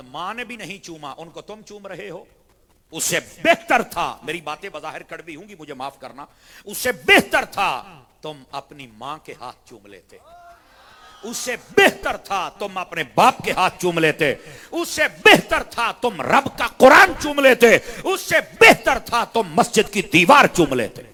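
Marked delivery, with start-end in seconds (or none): 2.9-4.2 loud preaching. Real, not acted. Preacher thundering at congregation. 6.6-21.0 rising, forceful repeated rebuke, louder and higher each time. Real, not acted. Preacher scolding the congregation, building to a shout.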